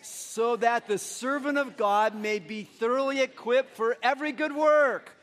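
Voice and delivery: slow, emphatic recitation rising at the end; coaching recitation. Real, not acted. Pastor rejoining the church's recitation, coaching them on emphatically. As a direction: drive the group home to the last phrase. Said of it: "I'll coach you on".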